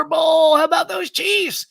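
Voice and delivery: a big shouted Bowl, pitch jumping way up; cheering the Chiefs win like a fan; excited cheer. Retold or imitated. Reading a fan's chat about the Chiefs win, shouted cheer.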